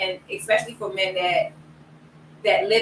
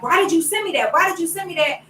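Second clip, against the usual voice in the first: high-pitched mock scolding; heated, higher-pitched complaint; retold yelling; 9 semitones higher, 4 dB louder than usual. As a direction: re-enact snapping at your mother. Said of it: "after yelling at her".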